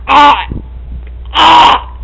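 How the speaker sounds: sudden yelp. Retold or imitated. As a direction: yelp out of nowhere. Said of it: "I was like".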